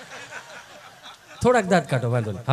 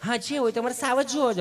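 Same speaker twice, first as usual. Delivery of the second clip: slightly higher, prim polished Gujarati; a hint of a snooty visitor; mock educated visitor; 5 semitones higher than usual. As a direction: lightly play the fussy city guest. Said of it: "ચીપી ચીપીને બોલે".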